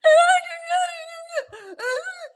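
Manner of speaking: high, loud mock sobbing wail; hammed-up fake crying; mock fake sob. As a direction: imitate a hammed-up fake cry. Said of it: "Gretchen's like".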